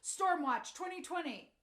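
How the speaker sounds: high, loud announcer hype; urgent news teaser. Retold or imitated. TV news promo grabbing viewers before the rainy season, loud and urgent. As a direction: sell the storm coverage like a breathless promo. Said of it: "you're gonna hear this on the news".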